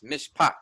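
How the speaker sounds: "mishpat" as mish-PAT, second syllable emphasized; "mishpat" demonstrated with a strong final syllable; stress on last syllable. Shown as a demonstration. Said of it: "pronounce this syllable with more emphasis".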